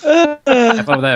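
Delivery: high, slow mock cheer; sarcastic. Real, not acted. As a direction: sing it out sarcastically. Mocking sign-off to listeners.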